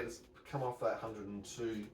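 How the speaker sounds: ordinary talk, nothing marked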